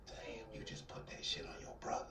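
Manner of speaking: hushed unvoiced whisper, stunned. To his partner after the news, a stunned whisper. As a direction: breathe it out in disbelief.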